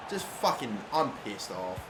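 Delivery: ordinary delivery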